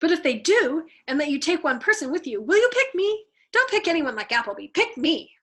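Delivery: nervous airman begging to get out too; a hint of a worried pilot pleading; Havermeyer pleading. A narrator's reading. Havermeyer begs to be picked.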